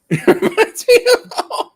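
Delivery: high squeaky laughing echo. Real, not acted. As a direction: echo the line through a laugh.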